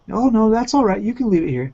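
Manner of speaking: a hint of a sweet hooker insisting; mock prostitute voice.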